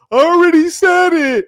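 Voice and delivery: mock him like a whining child; whiny little kid. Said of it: "you're not gonna get to be a little kid here".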